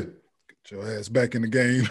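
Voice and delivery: low, quiet, slow coach command; stern coach. Retold or imitated. Coach's gesture voiced.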